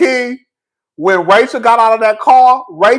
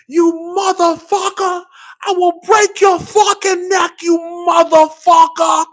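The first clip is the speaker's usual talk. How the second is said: furious mob wife; 9 semitones higher than usual. Retold or imitated. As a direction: scream it like a brawling mob wife. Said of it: "this is how the women used to talk".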